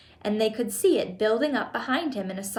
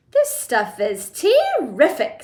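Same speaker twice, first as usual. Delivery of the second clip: put-on greedy boy; 3 semitones higher, 6 dB louder, slower than usual. A narrator's reading. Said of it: "said Augustus".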